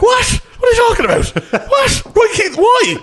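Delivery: very high, loud startled yelps; shocked disbelief. Retold or imitated. Teen woken by mum's news, squealing in shock. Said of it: "And I'd be like".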